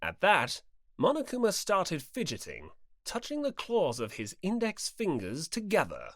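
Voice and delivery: usual speaking voice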